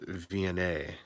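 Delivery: "V N A" ending in a long "ay"; final E pronounced. Shown as a demonstration. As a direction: say "V N A" as vee-en-AY. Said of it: "the E at the end is pronounced".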